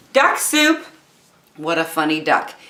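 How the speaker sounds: ordinary delivery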